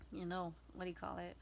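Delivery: ordinary talk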